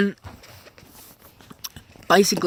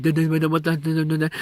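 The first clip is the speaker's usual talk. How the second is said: mock stammer, choppy and halting; imitated stuttering; faster than usual. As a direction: stutter it like someone in shock. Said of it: "she's stuttering".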